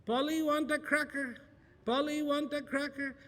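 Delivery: high squawky parrot; high parrot mimicry. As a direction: parrot it back mindlessly. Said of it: "the parrot says".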